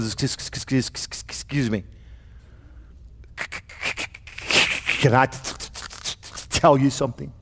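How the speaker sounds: halting, stammered words, quiet and low; stuttering. Retold or imitated. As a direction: stumble over every word like a stammerer. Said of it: "Moses had a stuttering problem".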